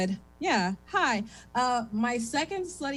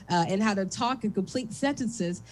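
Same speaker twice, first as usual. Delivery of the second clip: ordinary talk, nothing marked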